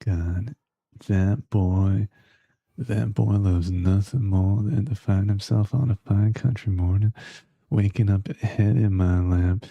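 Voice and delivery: put-on country Southern accent; American Southern accent. Streamer showing off for Beef, American Southern accent. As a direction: play a doting Southern belle with a country accent. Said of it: "I've got a good American accent".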